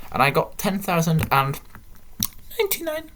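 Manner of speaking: ordinary delivery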